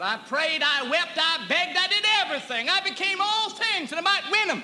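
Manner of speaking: mock complaint. Retelling his complaint to God.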